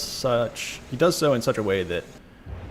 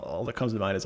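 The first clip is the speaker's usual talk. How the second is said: ordinary delivery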